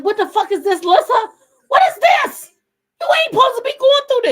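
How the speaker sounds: high, loud angry scolding; mad brother. Retold or imitated. Sister retells her brother raging over no babysitter, mad shouting. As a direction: imitate an outraged relative shouting. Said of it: "He was so mad".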